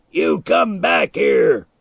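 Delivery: slow, loud angry yell; screamed order. A narrator's reading. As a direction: drag out the shouted command. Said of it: "Milo screamed".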